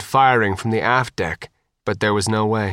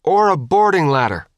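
higher, raised calling voice; shouted back; 6 semitones higher, 4 dB louder than usual. A narrator's reading. Hooch adds a second boarding option, shouting back. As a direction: keep calling the answer across. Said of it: "Hooch shouted back".

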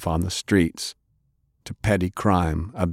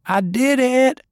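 much higher, loud triumphant shout; 5 dB louder, slower than usual. A narrator's reading. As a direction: yell it out in triumph. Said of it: "Fay shouted".

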